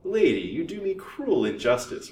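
high, hypocritically meek protest. A narrator's reading. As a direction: plead innocence with put-on meekness. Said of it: "was the hypocrite's meek reply".

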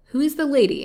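high, loud demand; doctor pressing for an answer; stern questioning. A narrator's reading. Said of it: "looking sternly at her".

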